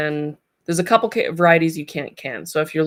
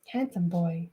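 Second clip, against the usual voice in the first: ordinary talk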